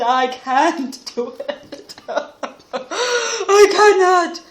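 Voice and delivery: hammed-up sobbing, slow, rising high and loud; crying hunter. A narrator's reading. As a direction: wail it out in mock tears. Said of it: "and he was crying".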